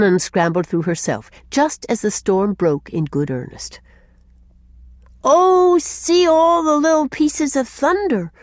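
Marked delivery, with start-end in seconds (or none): 5.2-8.3 high, drawn-out cry of delight; high little-boy voice full of delight; delighted child. A narrator's reading. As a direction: squeal it slowly with wonder, like a small child. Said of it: "cried Jims in delight".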